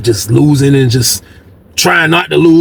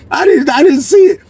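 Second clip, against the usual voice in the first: 1 laughing; 11 semitones higher than usual. Real, not acted. Laughing while telling.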